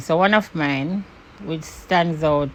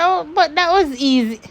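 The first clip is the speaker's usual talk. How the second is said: much higher, sing-song smugness; smug mock voice; 8 semitones higher than usual. Imitating the neighbour's smug remark, high and sing-song. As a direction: tease as the woman gloating. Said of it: "she was like".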